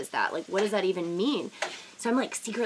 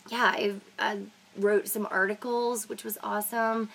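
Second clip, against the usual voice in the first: ordinary delivery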